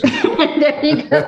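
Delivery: laughing. Real, not acted. Laughs while speaking.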